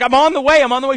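rushing to reassure her; panicked reply. Retold or imitated. Late actor answering his agent, flustered and loud.

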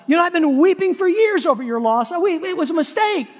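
very high, hammed-up pleading; another excuse-making brother. Preacher playing a guilty brother apologising to Joseph, high overdone pleading. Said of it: "Maybe another brother would have said".

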